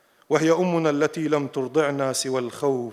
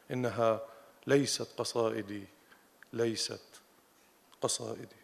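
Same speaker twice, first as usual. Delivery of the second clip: low, very soft, fading away; hushed poem ending; 4 semitones lower, 11 dB quieter than usual. Acted. Poet closing on "not my poems", hushed and fading. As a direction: let the refrain sink to near silence, with long pauses.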